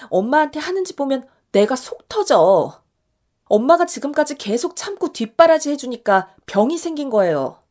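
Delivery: 높고 크게, 엄마 대신 속 터져 하며; 답답함이 쌓여 폭발하는 딸. Acted. 딸이 엄마 대신 분통 터뜨림. (엄마의 희생에 속 터진 딸의 높고 큰 분노)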